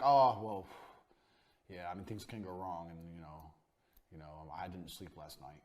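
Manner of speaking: low, quiet, hesitant mumble of a doctor with no sleep; nervous doctor. Retold or imitated. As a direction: trail off like an unsure surgeon. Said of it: "or nervous?".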